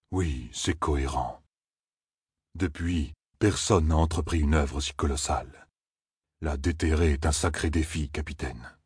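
low, soft, measured figure; ponderous Loyal. A narrator's reading. Companion explaining the statue's age to the captain, low and pondering. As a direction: reason it out in a low, unhurried voice.

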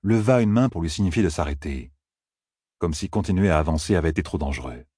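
usual speaking voice

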